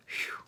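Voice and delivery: soft, whispered puff of breath; breath-only phew; relieved phew. Acted. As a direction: blow out a quiet sigh of relief. Relief at the rescue.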